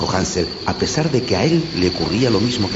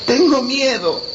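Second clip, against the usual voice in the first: put-on high child's voice, scared; whisper-small fear in a child's voice; frightened little girl; 4 dB louder, slower than usual. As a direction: Gretel admitting fear in the dark forest. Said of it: "dijo Gretel".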